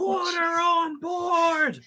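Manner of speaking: very high, drawn-out cry of alarm; screaming. Acted. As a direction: call it out slowly, high and urgent. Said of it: "start screaming".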